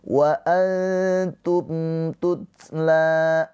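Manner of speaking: Wa antum tutla slow, mim said clearly. Shown as a demonstration. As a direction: chant it long with a clear mim. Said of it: "Mimnya ini dibaca dengan jelas".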